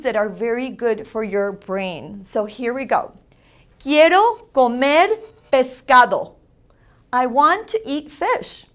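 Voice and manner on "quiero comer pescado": "Quiero comer" lifting, pause, "pescado" falling; teacher's clearly separated "Quiero comer, pescado"; accentuated Spanish vowels. Shown as a demonstration. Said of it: "vowels are very important that you accentuate".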